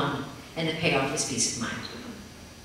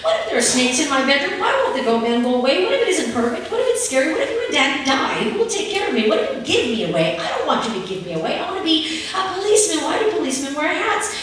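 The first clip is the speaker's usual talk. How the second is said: put-on higher, louder tearful child's rush; sobbing babble; 8 semitones higher, 5 dB louder than usual. A narrator's reading. Upset child on mother's shoulder, higher tearful babbling. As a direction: babble through sobs. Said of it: "sob and babble".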